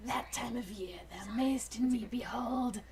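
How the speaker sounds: ordinary delivery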